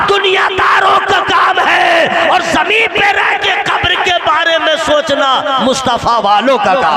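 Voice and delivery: loud, driving Urdu sermon; fiery preaching. Acted. Preacher rousing the congregation, loud and fervent. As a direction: preach it hard to the crowd.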